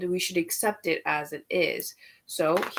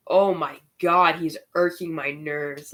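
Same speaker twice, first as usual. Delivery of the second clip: ordinary delivery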